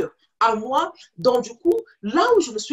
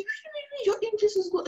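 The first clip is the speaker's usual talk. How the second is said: eager reassurance; 10 semitones higher, 4 dB quieter than usual. Retold or imitated. Neighbour reassures her kindly.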